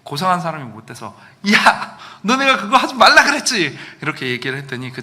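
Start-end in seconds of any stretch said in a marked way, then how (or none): 1.5-3.8 loud, high mock scolding of a friend, half laughing; scolding yell. Pastor retelling how he yelled at his struggling friend, loud scolding laugh. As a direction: bark it at the friend like I told you so.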